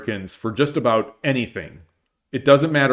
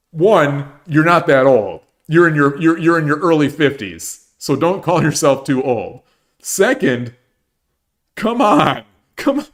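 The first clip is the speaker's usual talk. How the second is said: louder, higher exasperated scolding; exasperated retort; 3 semitones higher, 4 dB louder than usual. Retold or imitated. Telling the podcaster off, loud and exasperated. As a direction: scold him, rising to a cry of come on. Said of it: "And I'm like".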